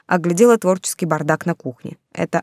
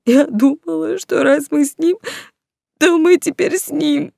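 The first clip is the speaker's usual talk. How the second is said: higher, mournful wail stretching vowels; 7 semitones higher than usual. A narrator's reading. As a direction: whine each vowel in grief. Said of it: "горестно подвывая на гласных". (горестно подвывая на гласных)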